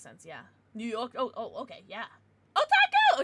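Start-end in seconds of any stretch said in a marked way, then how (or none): 2.6-3.2 excited greeting. Real, not acted. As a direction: squeal the name very high and loud. Greeting a chatter by name.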